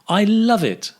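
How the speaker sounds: "I love it" pitched high, falling at the end; expressive intonation. Shown as a demonstration. Teacher asking learners to notice intonation, "I love it" high then falling. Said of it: "Notice the intonation".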